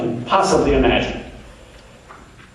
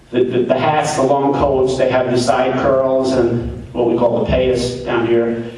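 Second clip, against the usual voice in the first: ordinary talk, nothing marked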